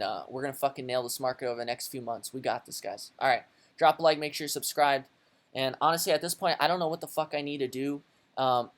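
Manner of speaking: ordinary talk, nothing marked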